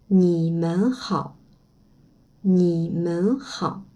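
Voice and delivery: slow ni men hao, stress on men; mandarin pronunciation. Shown as a demonstration. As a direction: say ni men hao slowly, syllable by syllable. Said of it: "c'est bonjour tout le monde". (ni men hao lentement, accent sur men)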